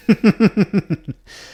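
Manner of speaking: loud high laugh; burst of laughter. Real, not acted. Laughs after whisper demo.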